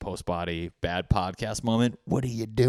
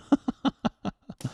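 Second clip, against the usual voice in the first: high-pitched burst of laughter; 12 semitones higher than usual. Real, not acted. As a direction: crack up at the joke. Laughs at own joke.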